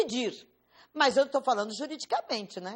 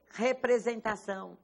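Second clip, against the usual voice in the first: usual speaking voice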